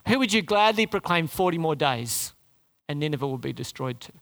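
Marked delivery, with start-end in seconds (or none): none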